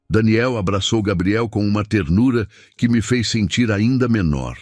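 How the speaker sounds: usual speaking voice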